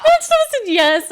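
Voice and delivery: loud, high laughter spilling into the words; cracking up at her own answer; laughing. Real, not acted. Telling the host about her one question, laughing.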